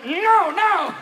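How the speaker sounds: high, loud, flustered denial; mock-panicked politician caught out; flustered old boss. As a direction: brush it off in a panic, slowing on the excuse. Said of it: "And he's going".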